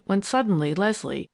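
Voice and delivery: plain reading voice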